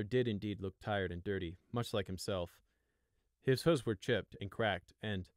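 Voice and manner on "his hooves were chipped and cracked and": ordinary delivery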